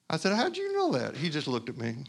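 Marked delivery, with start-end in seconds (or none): 0.4-1.2 much higher, startled question; astonished. Retold or imitated. The preacher asks the stranger how he knew, astonished and high. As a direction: blurt it out in disbelief.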